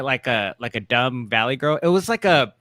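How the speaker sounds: ordinary talk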